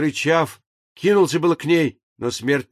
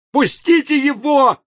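high, loud, slow cry out; sharp cry; 11 semitones higher, 5 dB louder, slower than usual. A narrator's reading. Death cries out at the men holding the engineer, high loud cry. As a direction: cry out to make them let go. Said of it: "вскрикнула смерть".

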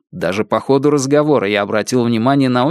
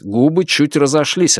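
usual speaking voice

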